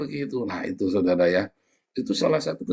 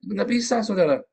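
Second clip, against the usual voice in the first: ordinary talk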